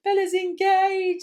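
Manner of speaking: high, slow quoting of Lucy; a hammed-up reveal; mock announcement. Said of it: "you were like".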